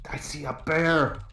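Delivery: hushed urgent shout, slowed; whisper yelling. Acted. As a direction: yell it under your breath. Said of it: "gonna whisper yell".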